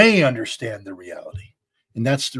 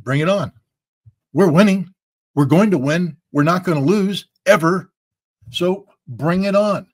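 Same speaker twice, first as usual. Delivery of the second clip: loud, higher, cocky challenge; defiant bravado; 4 semitones higher, 5 dB louder than usual. Retold or imitated. Pundit voicing Russians daring the West to send more, defiant bravado. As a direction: throw down the gauntlet. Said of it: "The Russian people are like".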